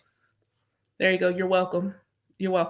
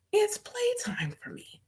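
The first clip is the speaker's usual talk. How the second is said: slow whisper pitched much higher; 13 semitones higher, 6 dB quieter, slower than usual. Acted. Repeating her joy to viewers in a high whisper. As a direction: squeak the words out in a gleeful whisper.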